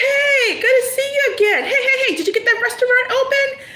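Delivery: very high, gushing hello with a catch and a laugh; overexcited greeting. Acted. Old friend greeting the would-be restaurant owner, high and gushing. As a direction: squeal hello at an old friend you're thrilled to see.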